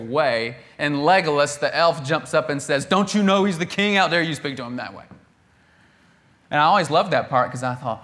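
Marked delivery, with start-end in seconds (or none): 2.8-5.0 indignant rebuke. Acted. Legolas rebuking disrespect.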